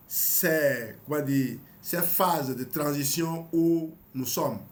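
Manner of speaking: normal talking voice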